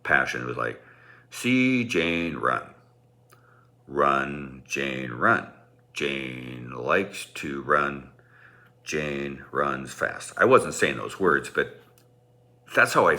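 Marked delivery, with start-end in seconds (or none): 1.3-10.3 slow, halting, flat reading of a primer; halting beginner reading. Shown as a demonstration. Speaker mocks his bad speech reading, slow and halting. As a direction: read it word by word like a struggling child reader. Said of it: "like this pace and cadence and inflection".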